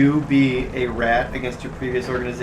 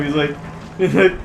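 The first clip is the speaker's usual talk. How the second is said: usual speaking voice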